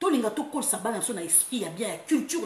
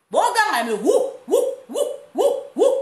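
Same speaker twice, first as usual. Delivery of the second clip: high loud hooting wou wou; mock crowd jeering; 10 semitones higher, 9 dB louder than usual. She imitates the crowd jeering the president, loud high hooting. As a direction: hoot like a rowdy crowd.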